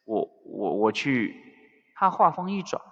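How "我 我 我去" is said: low, halting stammer; lost for words. A narrator's reading. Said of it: "余生一时语塞". (一时语塞，低声支吾)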